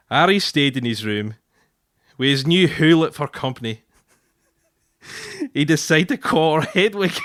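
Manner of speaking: broad Scots storytelling voice; Scottish accent. Shown as a demonstration. Husband reading his favourite bit to his wife, broad Scottish accent. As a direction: put on a thick Scottish accent. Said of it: "putting on the Scottish accent".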